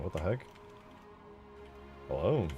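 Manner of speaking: usual speaking voice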